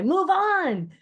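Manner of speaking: high, loud shout to a crowd; rallying cry. A narrator's reading. Aaron urging the Israelites onward into the sea, rallying shout. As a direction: call it out long and loud. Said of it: "took up the cry".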